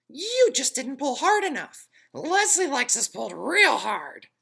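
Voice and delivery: tiny, high, loud tempting voice; pigtail voice. A narrator's reading. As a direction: lure him like a little devil. Pigtail persuades.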